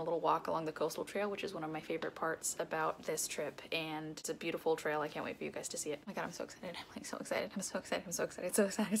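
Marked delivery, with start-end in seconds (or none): 6.0-9.0 whispered excitement. Real, not acted. Hushed repeated excitement.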